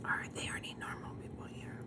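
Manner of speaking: breathy hushed whisper. Real, not acted. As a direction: whisper it so nobody hears. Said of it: "why are you whispering?".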